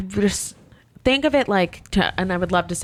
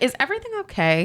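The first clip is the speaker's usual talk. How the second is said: sweet manager voice; 8 semitones higher, faster than usual. Retold or imitated.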